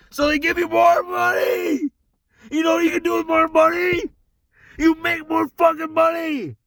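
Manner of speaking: worked-up shouted lecture; heated rant. Real, not acted. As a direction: hammer the lesson home, yelling and stretching line ends. Worked-up rant lecturing the listener on money.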